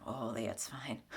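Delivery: soft breathless hiding of feelings; whispered brush-off. Retold or imitated. Imitating someone hiding their feelings, whispered. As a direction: mumble it away to nobody. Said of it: "versus like".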